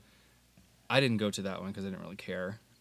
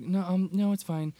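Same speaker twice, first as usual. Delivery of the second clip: flustered high deflection; 9 semitones higher, 5 dB louder than usual. Retold or imitated. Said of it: "Christina was like".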